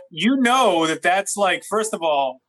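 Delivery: usual speaking voice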